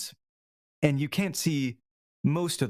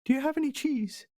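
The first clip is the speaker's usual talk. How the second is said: high squeaky mouse angling for food; hungry mouse; 13 semitones higher than usual. Acted. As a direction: squeak out a hopeful little request. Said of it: "not missing the chance for a meal".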